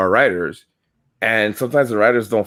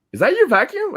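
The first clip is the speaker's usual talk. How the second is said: high-pitched, retold puzzlement; retold puzzled question. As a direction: act out asking, voice shooting way up. Retelling awkward question to passenger.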